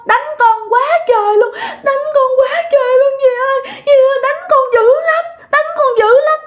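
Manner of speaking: acted toddler's complaint, rising to a high wail; tiny child voice. A narrator's reading. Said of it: "nói cái giọng nhỏ xíu". (giọng con nít cao vút, than khóc oán trách)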